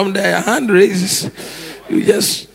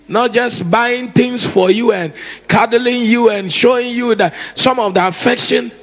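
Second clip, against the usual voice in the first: normal talking voice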